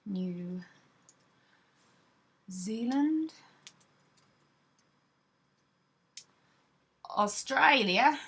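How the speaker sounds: slow, drawn-out Australian vowels on the place names; a playful, hammed-up Australian twang; amateur Australian accent; mock Australian accent. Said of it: "that's my best Australian accent".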